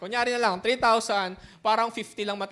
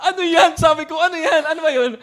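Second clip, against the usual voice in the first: higher and louder, baffled repeated question; loud confused asking; 6 semitones higher, 9 dB louder than usual. Retold or imitated. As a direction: demand to know what it is, laughing. (malakas at nalilitong tanong)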